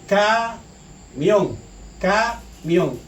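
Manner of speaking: "camión" drawn out slowly as ca, mión; separado en sílabas. Shown as a demonstration. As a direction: break "camión" into two syllables with a clear pause. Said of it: "es donde va la mayor fuerza de voz". ("camión" lento, sílaba por sílaba: ca, mión)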